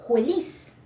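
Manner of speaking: jueliz with a breathy J sound in place of the F. Shown as a demonstration. Teacher demonstrating the jungle-region pronunciation of feliz. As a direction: say jueliz, a J where the F of feliz should be. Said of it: "otro ejemplito que te he traído que dice".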